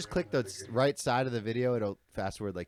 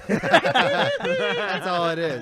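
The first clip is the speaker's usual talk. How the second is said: laughing; 5 dB louder, much slower than usual. Real, not acted. Laughing at the clip.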